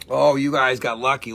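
ordinary delivery

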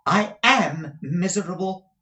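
high, slow, sulky wife; pouting. A narrator's reading. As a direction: whine it out with a pout. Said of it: "evidently pouting".

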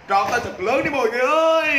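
high call ending in a long held "ơi"; rally the audience, voice climbing high; stretched-out showman's shout; hyped-up crowd call. Real, not acted. Host rallying viewers to applaud.

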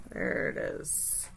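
slow, soft whisper of discovery. Real, not acted. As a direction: breathe it out quietly. Finding the deck.

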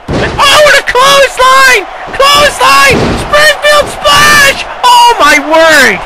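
excited shout. Acted. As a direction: scream it like a ringside announcer. Big move in wrestling match.

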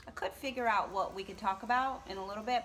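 normal talking voice

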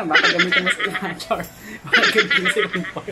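cheeky line through laughter; laughing. Real, not acted. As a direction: tease it while laughing. Cheeky joke about the vibration.

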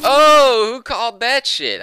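high, very loud gloating; loud triumphant crowing. Real, not acted. Player boasting he called it, loud triumph. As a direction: crow it in triumph. Celebrates a correct call.

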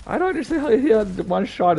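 loud indignant rant; fuming about an unfair kill; raging gamer. Real, not acted. Gamer complains about one-shot.

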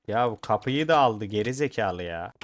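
loud, exasperated shout; angry shout. Real, not acted. Gamer yelling at a villager who took the door, furious. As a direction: blast the villager for grabbing the door.

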